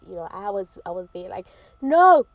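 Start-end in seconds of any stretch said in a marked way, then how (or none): 1.8-2.2 a sudden loud burst of no; shouted no. Real, not acted. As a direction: cut it off with a loud no. Exploding at the quoted excuse.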